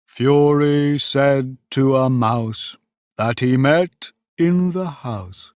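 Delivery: slow, drawn-out storytelling verse; verse recitation. Acted. Mouse recites its long tale, slow recitation. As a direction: intone the rhyme very slowly.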